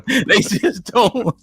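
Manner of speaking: laughing through the words; chuckling it out slowly. Real, not acted. Laughing at a bad comment.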